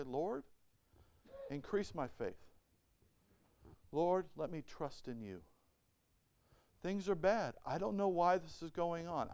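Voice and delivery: soft, quiet trusting prayer; a soft whispered-down prayer of trust; humble prayer. Retold or imitated. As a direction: pray it gently, surrendering.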